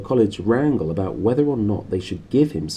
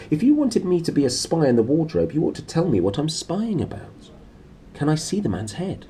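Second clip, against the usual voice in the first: put-on a higher, eager young girl's voice; lightly voiced girl; 8 semitones higher than usual. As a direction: plead like a curious child. A child pressing her uncle.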